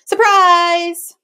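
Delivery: high, loud shout of surprise. A narrator's reading. Harry grabbing Benjamin's shoulders, a high loud shout. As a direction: leap out and shout it. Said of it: "and shouted".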